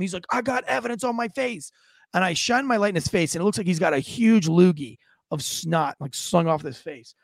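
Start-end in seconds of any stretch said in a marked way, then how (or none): 0.3-1.7 high mock insistence; frantic victim. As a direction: imitate a worked-up victim pleading his case. Retold frantic victim to officer.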